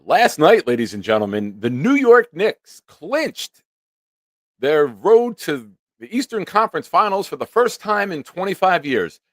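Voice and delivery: high, booming professional radio announcer. Retold or imitated. Jake scolding the early caller, in announcer voice. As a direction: put on the polished on-air voice. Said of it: "his radio professional voice basically says to me".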